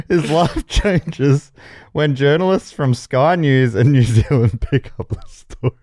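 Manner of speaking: real laughter squeezing the words; reading through laughter. Real, not acted. Host finishes cow synopsis, struggling through laughter.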